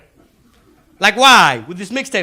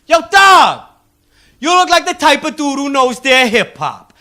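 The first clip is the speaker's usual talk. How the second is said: loud, high street-hustler hello; hustler's greeting; 7 semitones higher, 9 dB louder than usual. Retold or imitated. Comedian acting out the salesman greeting him loudly. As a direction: call out like a friendly hustler. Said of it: "he was like".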